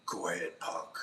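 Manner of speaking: low, soft, slow rasping threat; a light take on Clint Eastwood, low and soft; Clint Eastwood impression. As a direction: growl it quietly like a tough cop. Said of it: "try to do Clint Eastwood".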